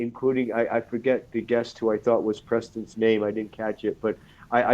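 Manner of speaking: ordinary talk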